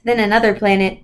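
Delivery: usual speaking voice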